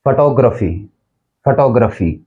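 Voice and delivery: फोटोग्राफी as fuh-TOG-ruh-fee; फोटोग्राफी repeated with weak "fuh" and "gruh"; correct pronunciation. Shown as a demonstration. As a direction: model फोटोग्राफी with a short "fuh". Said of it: "इसका जो actual pronunciation है".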